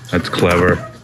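ordinary delivery

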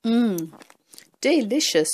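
talking while crunching. Real, not acted. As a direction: mouth full. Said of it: "Sorry about all the crunching".

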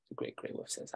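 usual speaking voice

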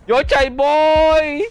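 long drawn-out loud call. Real, not acted. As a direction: call out to a teammate, stretching it. Calling teammate in game.

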